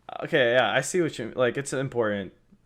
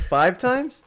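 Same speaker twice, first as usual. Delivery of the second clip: incredulous; 14 semitones higher, 8 dB louder than usual. Real, not acted. Incredulous at reading frequency.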